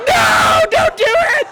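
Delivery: very high, loud plea; panicked scream. Retold or imitated. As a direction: scream to stop him. Screaming at brother choking dog.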